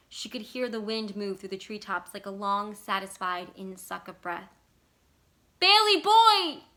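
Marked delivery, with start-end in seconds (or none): 5.6-6.6 very high, loud, drawn-out cry; desperate call. A narrator's reading. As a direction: call out in anguish. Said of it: "she called".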